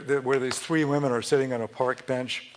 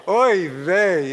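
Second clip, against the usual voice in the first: loud, high lament; exasperated oy vey; 5 semitones higher, 7 dB louder than usual. Retold or imitated. Grandson imitating his grandmother in a loud lament. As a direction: throw it out with despair. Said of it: "a combination of hand gestures".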